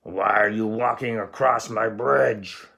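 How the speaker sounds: loud yelling troll. Acted. Troll yelling at the big goat on his bridge. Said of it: "yelled the hideous".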